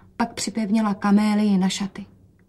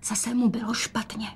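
hushed whisper, softer; 2 semitones higher, 5 dB quieter than usual. A narrator's reading. Rozetka whispers to her mother about the sick father. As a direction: whisper it so the father can't hear. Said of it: "dodala šeptem".

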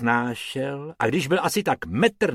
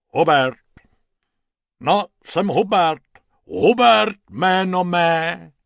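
pompous hippo introducing himself; lightly voiced hippo; 6 semitones higher, 6 dB louder than usual. A narrator's reading. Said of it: "řeklo hroším hlasem".

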